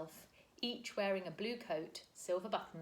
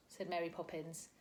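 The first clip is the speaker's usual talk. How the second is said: usual speaking voice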